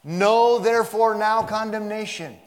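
"Know" high, loud and held in a slow proclamation; emphatic. Shown as a demonstration. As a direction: answer the doubt by booming "Know". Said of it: "what I meant by emphatic".

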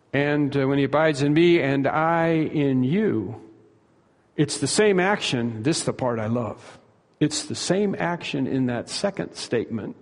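ordinary delivery

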